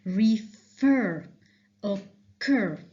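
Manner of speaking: "Refer, occur" with heavy pressure on fer and cur; stressed second syllable. Shown as a demonstration. Teacher showing where pressure goes, "Refer, occur" stressed on second syllables. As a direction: split "Refer, occur" and lean hard on each second syllable. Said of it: "I put a certain pressure on the next syllable".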